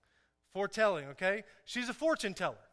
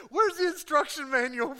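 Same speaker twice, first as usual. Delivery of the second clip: high, loud mock desperation; hammed-up frantic new-parent plea; desperate demand; 7 semitones higher, 9 dB louder than usual. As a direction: cry out for help, half joking.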